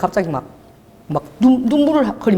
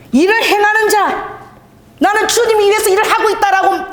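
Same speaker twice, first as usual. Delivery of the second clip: high preaching exclamation; 12 semitones higher, 4 dB louder than usual. Real, not acted. Pastor declaiming to the congregation, high and loud.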